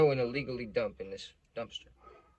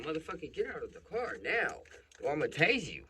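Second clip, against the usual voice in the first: higher-pitched imitation of an irate cop; threatening cop order; 5 semitones higher than usual. Cop orders dad out of the car, threatening. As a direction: act out an officer's angry threat.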